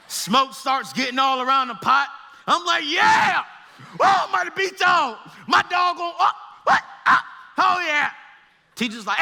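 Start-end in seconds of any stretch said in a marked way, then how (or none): 2.9-8.1 shouted high and loud, cocky glee; gloating shout. Retold or imitated. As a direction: yell at the frog like you're winning a race. Boy cheering, then startled.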